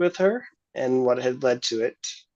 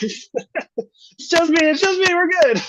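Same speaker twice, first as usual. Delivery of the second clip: much higher, very slow, giggling through it; laughing reassurance; much slower than usual. Acted. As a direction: laugh it off so she calms down. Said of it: "laugh at that".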